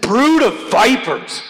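fierce rebuke. Acted. John the Baptist confronting the Pharisees, acted fierce rebuke. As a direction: hurl it slowly and loudly as an accusation. Acts John's harsh rebuke instead.

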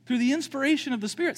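normal talking voice

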